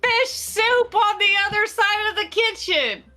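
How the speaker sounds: high-pitched, indignant telling-off; acted scolding. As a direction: scold the hapless cook in play. In-character scolding of Goyle.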